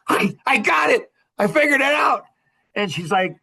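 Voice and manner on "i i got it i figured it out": high, loud, triumphant outburst; excited little boy. Retold or imitated. Husband bursting to share his discovery with his wife, boyishly excited. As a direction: burst out proudly like a small child. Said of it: "I'm a three-year-old boy".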